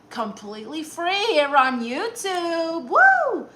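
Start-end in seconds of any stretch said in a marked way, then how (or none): 2.9-3.5 high, loud whoop of delight; excited cheer. Real, not acted. Streamer cheering her own show, a high whoop. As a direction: throw out a happy whoop.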